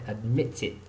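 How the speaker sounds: "admitted" with a crisp T, not a D; British pronunciation. Shown as a demonstration. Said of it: "British people say".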